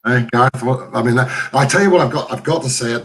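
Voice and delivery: ordinary talk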